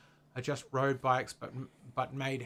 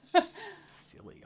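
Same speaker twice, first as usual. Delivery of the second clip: laughing. Real, not acted. Laughing at protective commenters.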